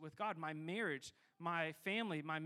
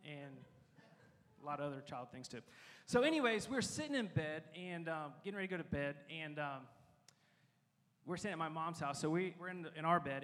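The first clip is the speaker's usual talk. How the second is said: usual speaking voice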